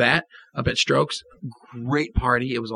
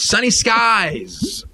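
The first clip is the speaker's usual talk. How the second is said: drawn-out excited cry; 9 semitones higher, much slower than usual. Pretend. Hyping a beautiful weekend.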